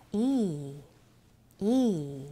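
いー with a pitch dip and rise; gliding ee sound. Shown as a demonstration. Teacher demonstrating a pitch-bending long ee. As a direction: stretch いー and bend its pitch. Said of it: "音程の上下を入れる".

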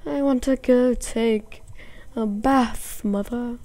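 slow, odd character voice; weird put-on voice. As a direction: say it in a silly voice, slowly. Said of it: "Okay, that was weird".

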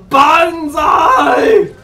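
loud, high, drawn-out charge yell; big stretched-out war cry; shouted battle cry. Pretend. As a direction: holler it as you charge in.

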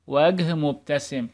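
ordinary delivery

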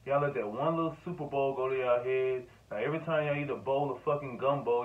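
usual speaking voice

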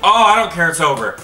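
ordinary talk

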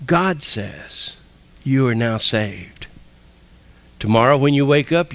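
ordinary talk, nothing marked

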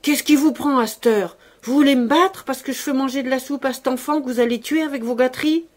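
indignant cry. A narrator's reading. Servant shoved off by her master, crying out indignantly. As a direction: cry out in angry outrage.

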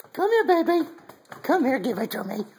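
very high squeaky coaxing voice. Real, not acted. Owner coaxing puppy to give up the bird, very high baby talk.

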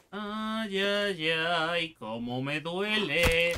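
slow hammed-up moaning; mock pain. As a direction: whine it like a fake wound. Mock pain while fighting.

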